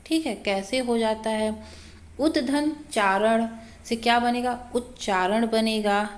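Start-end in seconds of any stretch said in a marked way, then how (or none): none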